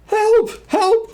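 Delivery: very high, loud squeal for help; panicked cry. A narrator's reading. Piglet sees what he thinks is a Heffalump, panicked high cry. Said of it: "cried Piglet".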